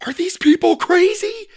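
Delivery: very high, loud shriek; alarmed boy's squeal; incredulous cry. A narrator's reading. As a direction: cry out in disbelief. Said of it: "cried Sawyer".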